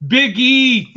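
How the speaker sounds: big, high, loud shout-out; calling out. Real, not acted. Host reads out a chat regular's name, calling out. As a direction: belt the nickname like a hype man.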